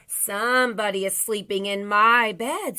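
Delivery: loud, indignant little bear; Baby Bear's voice. A narrator's reading. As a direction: cry it out, ending on a pitch jump. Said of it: "in his wee little voice".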